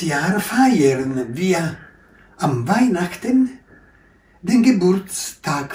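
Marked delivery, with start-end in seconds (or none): none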